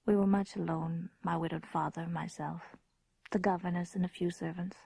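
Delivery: reflective storyteller narration; story narration. A narrator's reading. Grown woman recalling her childhood castle, hushed narration. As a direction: tell it as a memory tinged with dread.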